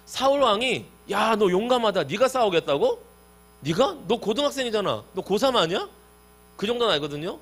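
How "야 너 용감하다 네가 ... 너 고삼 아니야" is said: high, raised mock disbelief; imitating astonished questioning; incredulous. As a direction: play a king amazed at a boy's nerve. Acts out Saul doubting young David.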